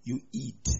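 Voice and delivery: very soft, halting, oracle-like mother; hushed mock-divine voice, pausing between phrases; mock solemn mom. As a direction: imitate a mother talking like a hushed prophecy. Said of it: "If your mom talks like that".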